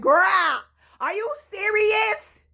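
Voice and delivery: high, slow, loud disbelief; mock disbelief. Retelling asking her friend in disbelief. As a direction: stretch it out incredulous. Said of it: "I was like".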